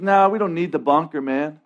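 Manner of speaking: offhand, laid-back brush-off; acted nonchalant refusal; casual dismissal. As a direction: wave the offer away, dropping out of preaching voice. Passer-by casually refusing the bunker.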